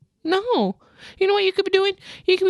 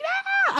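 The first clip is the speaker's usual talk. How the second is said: high-pitched mock wail; mock crying wail. Stylist retelling a client's happy reaction, mock crying wail. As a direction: let out a high, drawn little cry of joy. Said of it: "I wanted to start crying".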